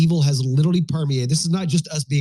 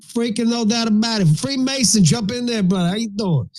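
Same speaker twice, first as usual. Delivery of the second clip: high, put-on New Yorker greeting; New York tough guy; 7 semitones higher than usual. Host greets Freemason as a brash New Yorker.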